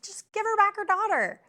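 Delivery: frustrated outburst at the show; exasperated plea. Real, not acted. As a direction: demand it in exasperation. Pleading annoyed at the show.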